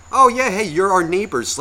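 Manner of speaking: high, loud mock greeting; imitated friendly neighbor. Beach neighbors greeting him too close, mock friendly. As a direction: mimic an over-friendly new neighbor.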